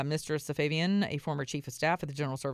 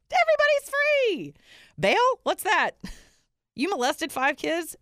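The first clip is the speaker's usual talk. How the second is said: mock disbelief; 6 dB louder than usual. Sarcastic mockery of New York.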